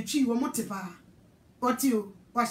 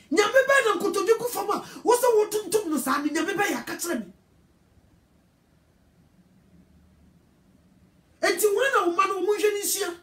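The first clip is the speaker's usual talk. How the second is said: preacher crying out to the congregation; fiery preaching; 5 semitones higher than usual. Acted. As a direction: preach it with full force. Preaching at full force.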